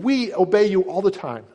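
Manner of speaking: self-assured leaders stressing "We obey you"; emphatic claim. Retold or imitated. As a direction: insist on "We obey you all the time". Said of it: "And that's the emphasis".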